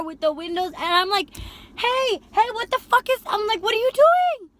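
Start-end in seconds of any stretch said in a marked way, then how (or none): 1.8-4.5 much higher, louder shouting at him; retold yell. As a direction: scream at the backseat. Yelling at passenger smoking.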